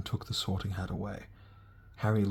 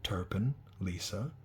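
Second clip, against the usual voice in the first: usual speaking voice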